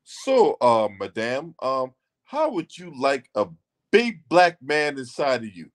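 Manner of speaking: low, hesitant, over-polite come-on; mock stilted suitor. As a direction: imitate an awkwardly courteous proposition.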